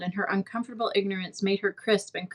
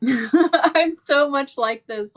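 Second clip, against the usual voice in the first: laughing, slow and higher; laughing aside; 5 semitones higher, 6 dB louder, much slower than usual. Real, not acted. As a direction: laugh as you admit it. Laughs recognizing herself in Anne.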